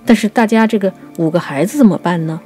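normal talking voice

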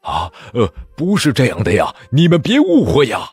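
loud, rising frantic plea; a monk shouting desperately to the army; panicked cry. Acted. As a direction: beg them to stop in a panic. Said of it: "灵英慌忙大叫".

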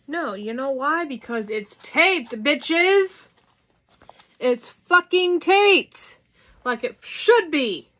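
loud, building comic outrage; rising exasperated yell; mock angry rant. Real, not acted. Ranting that the box is taped.

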